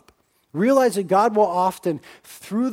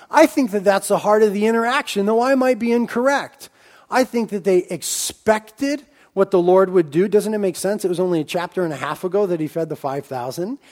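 normal talking voice

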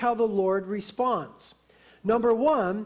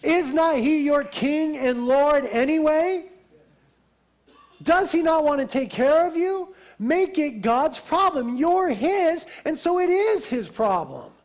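loud, raised, insistent preacher's challenge; emphatic preaching; 4 semitones higher, 5 dB louder than usual. Real, not acted. Preacher urging the congregation to hand God their problem, emphatic. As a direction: press each question home with force.

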